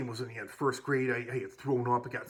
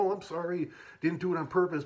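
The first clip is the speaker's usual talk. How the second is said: higher-pitched fake apology; feigned innocence; 6 semitones higher, 4 dB louder than usual. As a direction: act out a sorry you don't mean. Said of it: "pretending like it was an accident".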